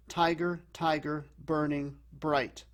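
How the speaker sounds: slow falling beat, TI-ger, TI-ger, BURN-ing, BRIGHT for "Tiger, tiger, burning bright"; trochaic stress. Shown as a demonstration. Teacher showing that the first syllable is stressed, trochaic beat on "Tiger". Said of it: "the first syllable is stressed".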